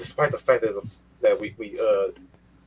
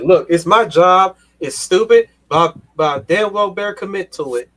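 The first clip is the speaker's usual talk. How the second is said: mock shout; 9 semitones higher, 8 dB louder than usual. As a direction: bellow it like a grand vow. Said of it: "He said".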